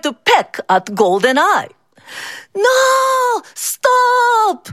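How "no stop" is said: high panicked scream. Acted. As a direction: shriek to stop the rooster. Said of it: "Mister Lee screamed".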